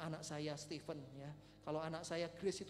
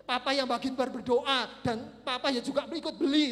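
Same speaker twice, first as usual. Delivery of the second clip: loud, raised retold protest; emphatic claim; 5 semitones higher, 12 dB louder than usual. Father argues he deserves son's ticket, loud and emphatic. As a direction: stake the claim at full volume.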